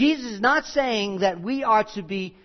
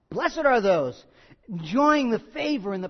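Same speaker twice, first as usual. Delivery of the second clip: preaching; 2 semitones higher, 5 dB louder than usual. Acted. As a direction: drive the verse home from the pulpit. Preaching at full force.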